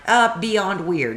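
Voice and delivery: slow, high and loud; sarcastic drawl. Retold or imitated. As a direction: drag it out in disbelief. Sarcastic retort.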